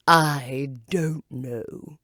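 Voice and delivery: very slow, loud caterpillar; a slow, contrary caterpillar. Acted. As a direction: drag the words out.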